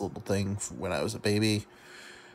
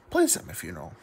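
usual speaking voice

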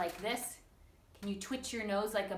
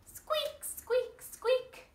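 mouse squeak; much slower than usual. Shown as a demonstration. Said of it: "That is what he says".

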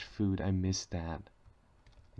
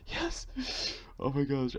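excited; 5 semitones higher than usual. Real, not acted. Got his stuff back.

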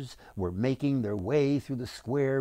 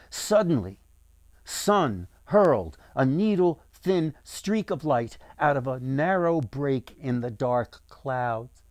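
plain reading voice

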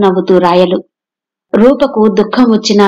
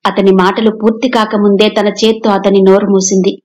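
ordinary delivery, nothing marked

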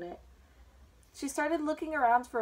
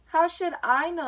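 louder, higher bold comeback; bold retort; 6 semitones higher, 7 dB louder than usual. A narrator's reading. Alice answering the queen back, surprised at her courage, bold. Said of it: "surprised at her own courage".

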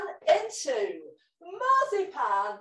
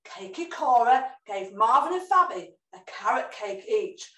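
plain reading voice

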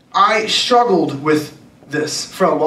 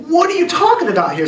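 higher, incredulous what-did-you-just-say tone; indignant disbelief; 10 semitones higher than usual. Retold or imitated. Shopper reacting to the old man insulting Americans, indignant and incredulous. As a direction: push back in shocked offense. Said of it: "And I'm like".

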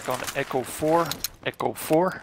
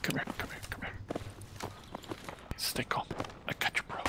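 soft unvoiced calming words; reassuring whisper; 14 dB quieter than usual. Real, not acted. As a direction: whisper to a hurt buddy. Calming a wounded teammate.

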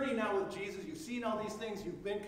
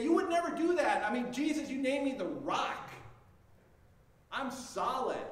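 higher and louder, a proud protest; insistent protest; 5 semitones higher, 5 dB louder than usual. Acted. Peter insisting to Jesus he will never turn away, loud and proud. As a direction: insist with swelling confidence.

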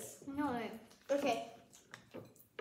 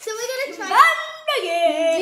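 louder, laughing through the words; laughing fit; 6 semitones higher, 8 dB louder than usual. Real, not acted. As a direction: crack up mid-sentence. Laughing fit on silly phrase.

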